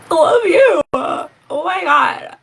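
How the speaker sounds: loud, high-pitched cry of love; gushing outburst. Retold or imitated. Mother overcome by her five-year-old son's words, gushing loudly. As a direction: shout it high, drawing out the end. Acts out gushing reply to son.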